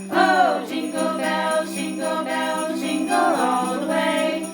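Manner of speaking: plain reading voice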